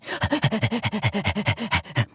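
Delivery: quick rhythmic doggy pants; dog panting. Acted. Dog called by the fox, eager panting. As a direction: pant fast like an eager dog.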